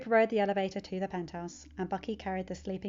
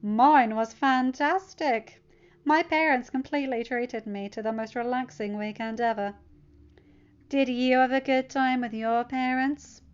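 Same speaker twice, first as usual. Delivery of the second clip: snide; 4 semitones higher, 6 dB louder than usual. Acted. Bully taunting Peter at lunch, snide. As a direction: drag it out mockingly.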